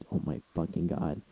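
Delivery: very quiet, low retold shock; hushed, low disbelief to yourself; stunned. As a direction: say it under your breath, dazed. Retells shock after nearly crashing.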